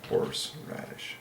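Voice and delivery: hushed whisper of horseradish; whispered word. Real, not acted. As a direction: whisper it softly.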